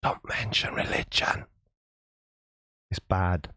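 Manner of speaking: whispered warning, no voice at all; mock whisper. Streamer whispers a warning to chat about religion, hushed. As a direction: hush it like a secret you shouldn't share.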